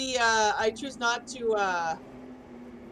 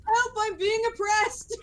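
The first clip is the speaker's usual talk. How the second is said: mock outcry; 7 semitones higher than usual. Dragged to dungeon, screaming.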